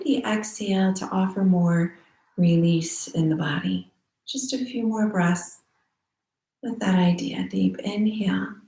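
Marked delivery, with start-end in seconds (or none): none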